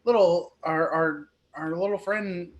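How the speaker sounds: worried pony. Acted. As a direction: fuss over the fallen dragon. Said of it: "she says".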